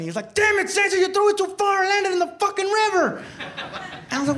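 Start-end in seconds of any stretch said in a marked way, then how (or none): 0.3-3.2 high-pitched acted scolding; mock angry yell. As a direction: chew him out from across the battlefield, pitched way up. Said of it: "somebody yells at me".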